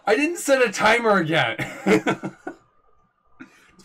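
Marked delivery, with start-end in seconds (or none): 0.1-2.4 laughing. Real, not acted. Laughing at own mistake.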